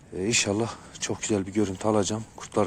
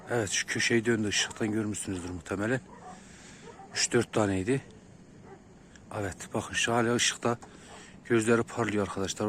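quiet, careful so the wolf stays; hushed voice; 8 dB quieter than usual. Real, not acted. Wolf close by, keeping voice down.

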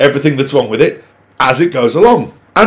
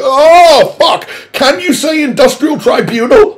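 sharply higher, shocked cry of oh; high exasperated outburst; appalled outburst; 9 semitones higher than usual. Real, not acted. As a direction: recoil in mock horror. Outraged at boss's line.

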